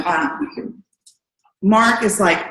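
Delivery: usual speaking voice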